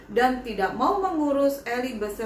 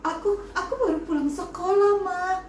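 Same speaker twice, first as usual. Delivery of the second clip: put-on frightened child; 6 semitones higher than usual. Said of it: "Dengan ketakutan Eli bilang".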